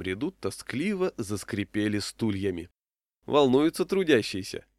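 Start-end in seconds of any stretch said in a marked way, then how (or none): 3.3-4.7 raised pitch, teasing jibe; lightly scoffing; mocking question. A narrator's reading. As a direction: ask it with a mocking lift. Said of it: "насмешливо спросил я".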